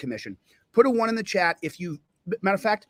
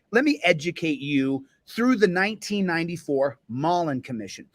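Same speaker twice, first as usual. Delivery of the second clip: normal talking voice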